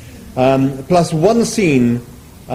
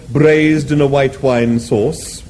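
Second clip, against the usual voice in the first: ordinary delivery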